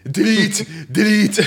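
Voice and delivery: high, punched-out catchphrase; mock catchphrase. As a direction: shout it like a wrestling catchphrase. Mimics catchphrase after name mix-up.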